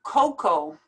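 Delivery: "Cocoa" as "koh-koh", no A at the end; silent A. Shown as a demonstration. Teacher showing the A is not pronounced, said carefully. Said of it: "don't even pronounce the A".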